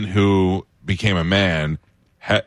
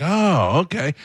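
surprised; 9 semitones higher than usual. Retold or imitated. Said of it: "we were like".

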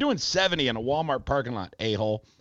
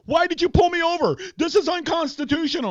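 high-pitched, louder mock outrage; mock a driver protesting the stop in a raised voice; indignant protest; 4 dB louder than usual. Driver objecting to being pulled over, high indignant protest.

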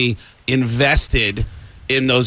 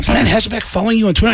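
fast, high question with "you" in disbelief; incredulous question; 7 semitones higher, much faster than usual. Retold or imitated. Friend doubting the news about "you", incredulous question. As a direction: ask quickly about "you", pitched up, incredulous.

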